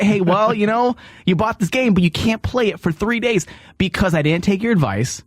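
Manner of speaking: ordinary talk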